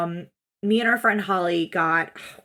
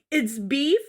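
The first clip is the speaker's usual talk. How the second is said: ordinary talk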